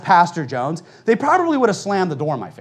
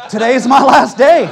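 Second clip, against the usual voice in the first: loud, high comic outburst; mock panicked shout; 6 semitones higher, 8 dB louder than usual. Repairman realising they mean him, mock panicked shout. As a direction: shout it for laughs as if desperate to leave.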